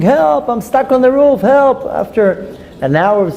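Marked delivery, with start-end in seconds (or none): 0.0-1.8 high mock plea for help; imitated screaming. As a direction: act out a stranded man calling down for help. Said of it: "He's screaming".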